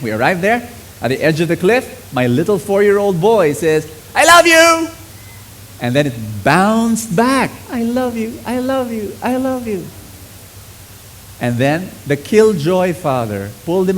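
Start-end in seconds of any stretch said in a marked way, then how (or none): 4.1-4.9 put-on high, loud little boy yelling; excited child calling out; child's shout. 7.7-9.9 high, fading repeated echo; distant echo bouncing back. Retold or imitated. Imitating the echo.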